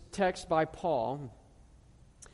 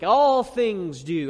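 "All" hit hard and loud, slow; stress on all; 7 dB louder, much slower than usual. Shown as a demonstration. The pastor showing the church the Greek word order, "All" stressed loud and slow. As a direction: lean on "All" to show it comes first in the Greek. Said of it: "it emphasizes the all things aspect".

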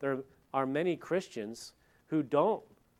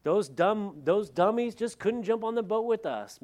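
loud, high, smug and dismissive; smug pastors; 8 semitones higher, 5 dB louder than usual. Retold or imitated. Pastor mocking smug preachers on the Titanic, loud smug gloating. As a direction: gloat from the lifeboat. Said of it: "they're saying".

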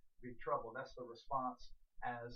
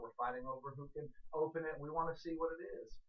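ordinary talk, nothing marked

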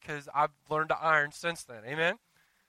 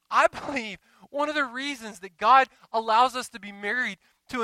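louder, higher preaching build; rising fervent preacher voice; 6 semitones higher, 6 dB louder than usual. Acted. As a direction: drive the point home to the congregation. Preaching passage builds in force.

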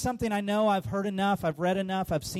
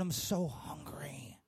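slow, breathless whisper of longing; 5 semitones lower, 9 dB quieter, slower than usual. Acted. Believer confessing hunger for God, whispered.

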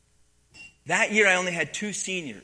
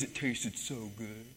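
soft, low, slow savouring voice; 5 semitones lower, 8 dB quieter, slower than usual. Retold or imitated. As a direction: relish every word like a treat. Wrestler savours the chicken fingers.

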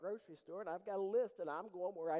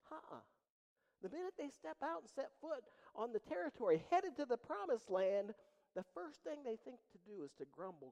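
higher, louder preaching voice; full-force preaching; 7 semitones higher, 8 dB louder than usual. Acted. Preacher rouses his church about Exodus, fervent. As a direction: drive it home to the congregation.

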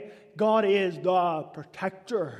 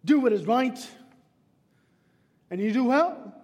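loud urging; 4 semitones higher, 6 dB louder than usual. Acted. Preacher urging Cain.

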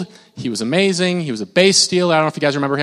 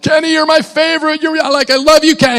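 higher, louder gushing fan voice; pleading fan; 10 semitones higher, 5 dB louder than usual. Retold or imitated. Fan pleading with an ignoring player, high and loud. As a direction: beg for his attention, adoring. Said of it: "I'm like".

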